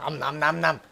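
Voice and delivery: normal talking voice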